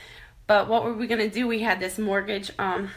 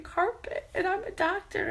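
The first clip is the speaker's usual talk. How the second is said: high, breaking voice through tears; sobbing out the word Carpet; crying; 11 semitones higher, 5 dB quieter than usual. Real, not acted. Crying at husband's job.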